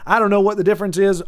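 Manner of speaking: normal talking voice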